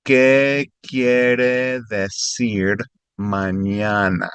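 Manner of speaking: each word drawn out slowly for a learner; slow teaching read. Shown as a demonstration.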